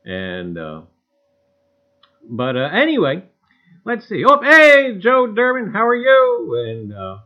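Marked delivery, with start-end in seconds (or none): 4.3-6.6 big, loud, high hail to a viewer he has just spotted; excited greeting. Real, not acted. Host on his live stream greeting a viewer in chat, loudly excited.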